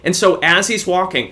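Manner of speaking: usual speaking voice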